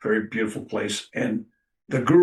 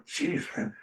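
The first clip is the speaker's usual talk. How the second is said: whispered and much softer; under breath; 7 semitones higher, 9 dB quieter than usual. Retold or imitated. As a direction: mutter it to yourself. Reluctant reaction under his breath.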